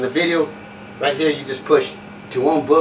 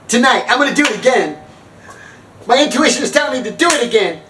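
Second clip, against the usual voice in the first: higher, insistent announcement; retold eager woman; 6 semitones higher, faster than usual. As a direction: imitate her hammed-up resolve. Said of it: "And she goes".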